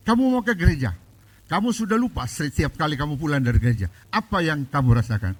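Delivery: retold husband's shout, loud and high; angry husband. Husband scolds wife for going to church, loud angry shout. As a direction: bark it at the wife like a furious husband. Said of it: "Wah, suaminya marah".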